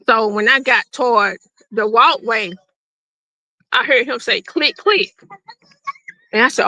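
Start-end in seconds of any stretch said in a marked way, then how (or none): 4.4-5.1 high clicking noise imitated with the voice; gun click imitation. Mother recalling hearing her husband cock the gun, imitated click. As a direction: mimic the gun's click, pitched up.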